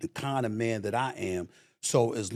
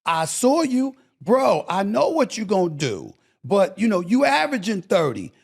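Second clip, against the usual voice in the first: mock plea to a player; 6 semitones higher than usual. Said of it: "I'm like".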